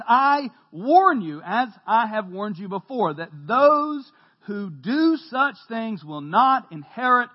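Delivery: slow, emphatic warning from the pulpit; scripture reading. Acted. Preacher reading Paul's warning to the congregation, emphatic. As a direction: stretch the key words and land them hard.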